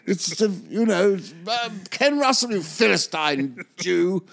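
exasperated star insulting him; outraged Oliver. Retold or imitated. Oliver scolding Simon for not knowing his film, outraged. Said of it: "He said".

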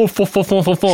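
a light mock French babble. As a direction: tease the French with a silly "fo fo". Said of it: "like when we make fun of French people".

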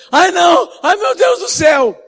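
very high, loud terrified shriek; hammed-up frightened cry; imitated panic. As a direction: scream like you're being shoved out a window. Retold Graciano panicking, being pushed.

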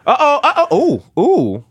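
burned mouth, hissing ooh; blowing on scalding tea; sipping hot tea. Pretend. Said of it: "this tea is so hot".

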